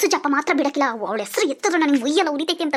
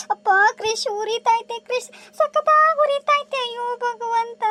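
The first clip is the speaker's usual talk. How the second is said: high, slow pained whimper; high whimpering moan of a burned hand; 7 semitones higher, slower than usual. Acted. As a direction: drag the words out in hurt. Vasu moaning about burn.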